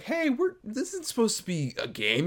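high mock telling-off; Grandma Stacy. Grandma scolds kids for playing while cleaning, high mock exasperation. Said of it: "And she's just like".

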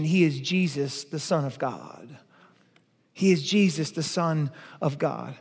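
soft, slowing on the Son of God; reverent hush. Acted. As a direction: say it low and tender like a confession of faith.

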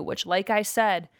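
normal talking voice

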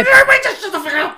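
very high, fast, loud yell; mock shout. Yelling at everyone while rigging equipment, mock shout. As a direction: scream it at everyone at once.

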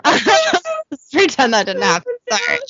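real giggly embarrassment; through laughter. Real, not acted. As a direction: barely get it out for laughing. Still laughing at own slip.

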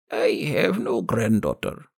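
put-on old crone, curt and gravelly; gravelly old woman. A narrator's reading. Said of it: "The old woman's gravelly voice".

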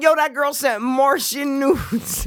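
high, slow mocking friend voice; imitating friend. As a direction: mimic him, slowed down. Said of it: "but he's like".

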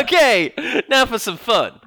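high, loud gleeful switch; hammed-up party-time cheer; mock happy Chun-Li. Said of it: "a very happy Chun-Li".

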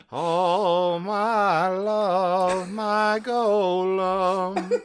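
slow, drawn-out mock love declaration; mock romantic Golem. The Golem swoons over the lady Golem, hammed-up and slow. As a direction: croon it like a lovestruck statue.

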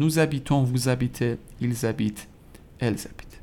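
nous habitons, vous habitez each linked by a z, h silent; liaison with z. Shown as a demonstration. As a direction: recite nous habitons through elles habitent with z joining each pair.

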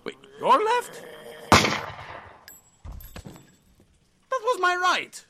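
high, loud incredulous outburst; surprised. Acted. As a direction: catch the mix-up, incredulous. Confused by directions.

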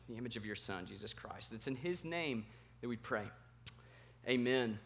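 soft, low, earnest prayer voice. Real, not acted. Pastor praying for the congregation, softly and earnestly. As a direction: pray quietly over the room before the sermon.

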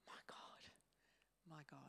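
breathed almost silently, unvoiced; hushed whisper. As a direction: whisper it as a broken prayer. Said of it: "And he goes".

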